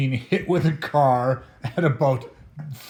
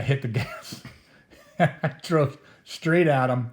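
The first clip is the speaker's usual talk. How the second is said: breaking into a laugh mid-sentence; real laughter creeping into the story; laughing while telling. Real, not acted. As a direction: tell it through a guilty chuckle. Laughs while confessing his reckless act.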